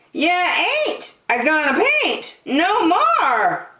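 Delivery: high hollering mama voice; mama's holler. A narrator's reading. Mom scolding her messy kid, hollering. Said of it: "I heard my mama holler".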